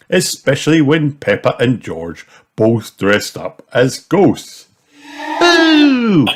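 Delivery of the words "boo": very high, loud laughing boo; ghost boo. A narrator's reading. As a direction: jump out with a boo. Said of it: "shouted Peppa".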